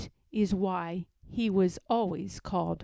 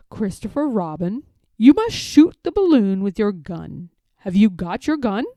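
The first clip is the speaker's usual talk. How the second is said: louder, urgent bear voice; Pooh; 10 dB louder than usual. A narrator's reading. Pooh asks Christopher Robin to shoot balloon, urgent bear voice. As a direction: call down for help, pressing.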